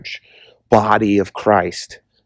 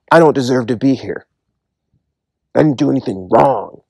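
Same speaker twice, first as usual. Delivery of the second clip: a mild, understated protest; people in hell crying out. Retold or imitated. People in hell insisting to God they did nothing wrong, mildly protesting. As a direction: voice of the unrepentant, pleading innocence in a near-usual tone. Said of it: "they're crying out".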